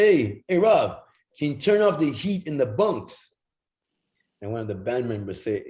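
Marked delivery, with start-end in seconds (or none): 0.1-3.2 higher, louder call up the bus. Retold or imitated. Ziggy calling out to driver Rob about the bunk heat, loud. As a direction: shout ahead to the driver.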